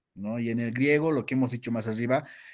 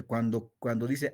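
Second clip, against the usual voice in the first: usual speaking voice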